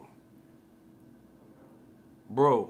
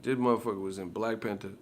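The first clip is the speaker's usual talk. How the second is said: normal talking voice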